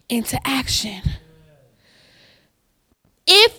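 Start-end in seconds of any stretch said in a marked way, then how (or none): 0.1-1.0 soft whispered close. Acted. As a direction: breathe the point out in a whisper. Hushed closing point.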